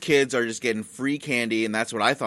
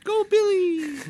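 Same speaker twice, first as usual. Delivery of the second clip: much higher cheer; mock cheer; slower than usual. Host cheers Billy Elliot, high and squealing.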